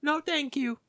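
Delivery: very high mock old-lady voice; old lady customer. Old lady correcting the cashier, high put-on voice. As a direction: pitch it way up, imitating the customer. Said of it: "This old lady comes up".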